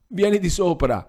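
high, urgent order upstairs; urgent command. A narrator's reading. Paolino ordering his sister upstairs, high and urgent. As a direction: summon her at once.

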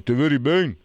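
Lombard dialect, lightly voiced; a touch of Lombard dialect; Lombard accent. Shown as a demonstration. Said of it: "questo è lombardo".

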